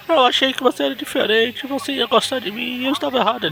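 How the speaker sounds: high whiny voice; mock heartbroken. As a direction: whine it like a jilted friend.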